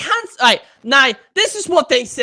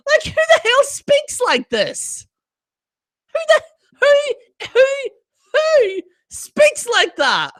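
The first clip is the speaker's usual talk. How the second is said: very high, incredulous squeal; incredulous outburst; 13 semitones higher than usual. Real, not acted. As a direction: shoot right up high in disbelief. Incredulous at the posh phrasing.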